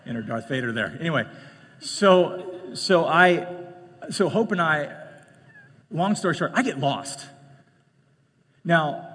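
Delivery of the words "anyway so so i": ordinary talk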